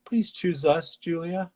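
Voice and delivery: high, begging plea. Retold or imitated. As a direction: plead with her not to go. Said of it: "And Nancy's like".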